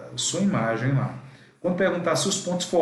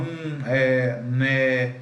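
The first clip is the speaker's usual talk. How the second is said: blanking out with halting ums; drawn-out hesitation sounds; hesitant fillers; 3 semitones lower, much slower than usual. Shown as a demonstration. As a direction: stall like you forgot the answer. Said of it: "dá aquele branco, aí você fala".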